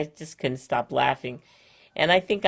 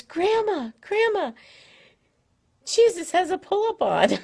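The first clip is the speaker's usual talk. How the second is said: put-on much higher, piping toddler voice with a laugh breaking through; little girl voice; 5 dB louder than usual. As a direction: mimic a three-year-old calling grandma, giggling. Grandma voicing three-year-old granddaughter.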